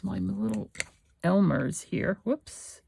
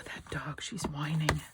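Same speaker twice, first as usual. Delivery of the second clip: whispered aside about the dog; soft whispered aside; 6 dB quieter than usual. Real, not acted. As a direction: whisper it so the video isn't disturbed.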